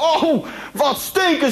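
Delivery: drawn-out, high cry of disgust; disgusted recoil. Acted. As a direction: reel back from a foul stench, stretching the words. Groom recoils from bride's breath.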